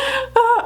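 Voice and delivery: squealing burst of laughter; high, crying laugh. Real, not acted. Laughing at the story.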